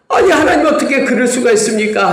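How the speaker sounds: high, pleading protest to God; plaintive complaint. Retold or imitated. Preacher voicing Israelites wailing at God, plaintive.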